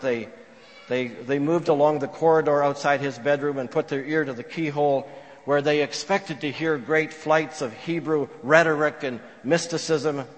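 normal talking voice

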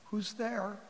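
soft, meek question; small, hushed and tentative; sheepish voice. Acted. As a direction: ask timidly from behind a door. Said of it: "this sheepish voice coming from the apartment".

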